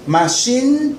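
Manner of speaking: machine with the final n held long; drawn-out machine. Shown as a demonstration. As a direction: hold the n of machine. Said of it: "I N no es nasal".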